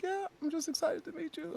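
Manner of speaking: very high, soft little voice; small, high-pitched imitation of a crying girl; imitated tearful fan. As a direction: mimic an overwhelmed fan in a tiny squeak. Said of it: "she's crying".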